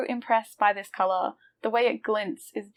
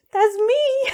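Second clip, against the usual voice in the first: high squeal then laugh; delighted squeal. Real, not acted. Seeing her colour name, high squeal and laugh.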